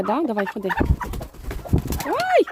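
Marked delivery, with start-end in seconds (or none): 2.1-2.5 startled yelp. Real, not acted. Sudden start in henhouse.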